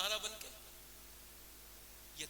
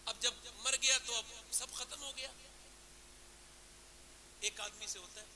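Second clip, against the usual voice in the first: ordinary talk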